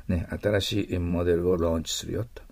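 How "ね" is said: ordinary talk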